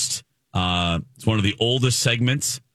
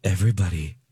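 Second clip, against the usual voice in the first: ordinary talk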